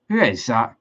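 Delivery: ordinary talk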